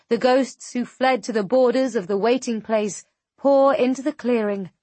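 plain reading voice

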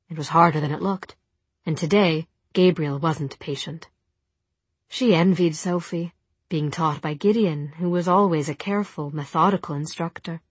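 ordinary delivery

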